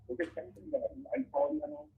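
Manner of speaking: muffled, garbled words. Real, not acted. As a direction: voice blurred as if covered. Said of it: "you got kinda muffled there".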